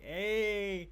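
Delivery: high, happy cheer; delighted. Retold or imitated. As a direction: greet the whiskey with delight.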